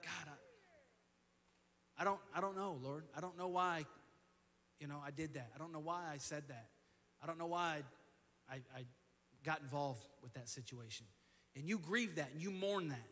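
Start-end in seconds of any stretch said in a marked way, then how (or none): 0.0-11.1 softer, lower, pleading to God; a broken, quiet prayer; mild contrite murmur; hushed confession. Pretend. Confessing prayer after failing God.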